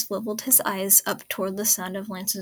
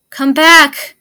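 loud, higher cry, pleading; desperate shout; 6 semitones higher, 18 dB louder than usual. Acted. Shiro begging Keith back to life, desperate shout. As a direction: scream it at a dying friend.

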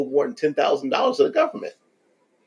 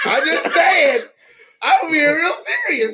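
giggling through it; laughing; 14 semitones higher, 3 dB louder than usual. Real, not acted. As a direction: laugh while saying it. Laughing while joking.

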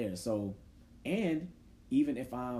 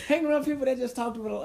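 laughing through it; 13 semitones higher, 5 dB louder than usual. Real, not acted. Laughing while asking.

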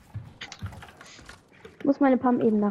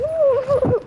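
high laugh; 13 semitones higher than usual. Real, not acted. Laughs at Arne.

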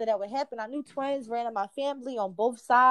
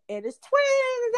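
much higher and louder, drawn out slowly; excited announcement; 13 semitones higher, 6 dB louder, much slower than usual. Retold or imitated. A nurse joyfully announcing twins to the patient, high and slow. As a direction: cheer the news out slowly, high and bright. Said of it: "that's how they said it".

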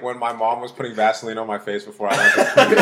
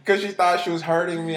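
ordinary talk

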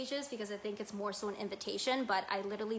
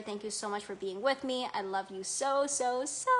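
ordinary delivery

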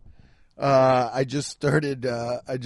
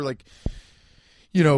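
normal talking voice

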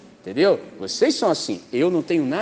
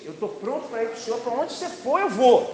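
eager follower; 6 semitones higher than usual. Retold or imitated. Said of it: "ele falou assim".

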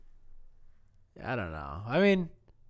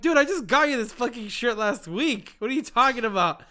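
exasperated dad; 8 semitones higher, 10 dB louder than usual. Retold or imitated. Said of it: "I'm like".

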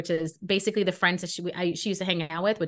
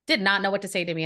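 ordinary delivery

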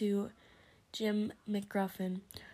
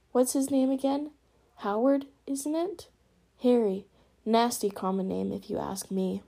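scornful; 2 semitones higher, 7 dB louder than usual. Acted. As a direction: sneer at the common name. Petunia scornful about nephew.

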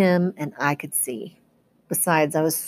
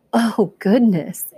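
awed Annabeth; 4 semitones higher, much slower than usual. Acted.

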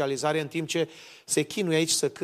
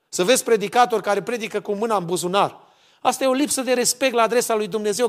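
raised, louder preaching, pressing the point; preaching at full force; 5 semitones higher, 5 dB louder than usual. Acted. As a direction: thunder the rebuke from the pulpit. (predică apăsată, mai tare și mai sus)

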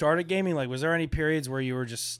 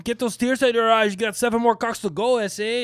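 mock dad voice; 7 semitones higher than usual.

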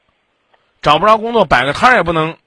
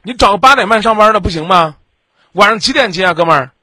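sarcastic scolding; 5 semitones higher, 6 dB louder than usual. Real, not acted. Host mocking caller's excuse.

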